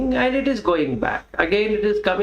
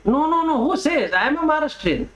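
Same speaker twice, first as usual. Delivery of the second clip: indignant, high-pitched denial; acted-out outraged insistence; worked up; 7 semitones higher, faster than usual. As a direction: protest in a heated, higher voice. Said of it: "I get so much worked up".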